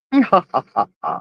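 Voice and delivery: low, spaced-out ha-ha-ha; playful, low evil laugh; mock villain laugh. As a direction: laugh like a plotting villain in jest. Mock villain laugh after assassination joke.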